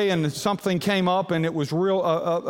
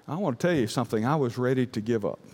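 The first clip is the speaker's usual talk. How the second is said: ordinary talk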